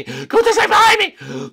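very high, loud shriek; hammed-up terror; panicked scream. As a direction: scream that the chaser is right behind you. Panicked repeat while chased.

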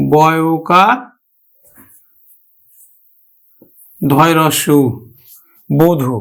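বধূ in pieces, then whole as "bo-dhu"; spelled out. Shown as a demonstration. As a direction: spell out বধূ with long pauses. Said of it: "আমরা উচ্চারণ করব কিন্তু". (বধূ বানান করে "বোধু" উচ্চারণ)

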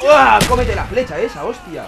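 loud gleeful battle cry; excited yell. Real, not acted. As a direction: whoop and gloat. Gamer hitting an opponent.

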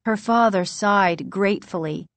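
ordinary delivery, nothing marked

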